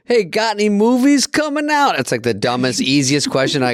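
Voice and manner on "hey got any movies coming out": imitating a fan. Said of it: "I get a guys go".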